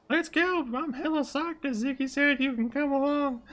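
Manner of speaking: very high falsetto enthusiasm; high-pitched psyched character; excited Flop. Acted. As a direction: gush in a high put-on sidekick voice.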